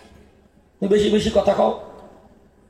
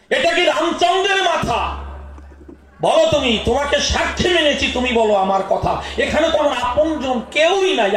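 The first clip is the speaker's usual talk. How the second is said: Sita's angry demand; 5 semitones higher than usual. Acted. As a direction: shout the question at Ravana in despair. Sita demands truth from Ravana. (সীতার ক্রুদ্ধ চিৎকার)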